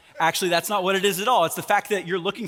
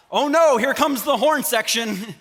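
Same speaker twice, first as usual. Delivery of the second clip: mock dread; 5 semitones higher, 5 dB louder, slower than usual. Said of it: "I'm a sarcastic individual".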